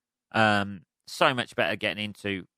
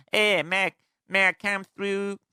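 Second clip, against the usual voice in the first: mock Italian accent; 8 semitones higher than usual. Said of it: "with a fucking stupid accent".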